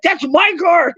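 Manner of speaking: high, yelled insistence; mock angry shout. Car owner yells back at the teen in a mall parking lot. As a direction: bellow that the car is his.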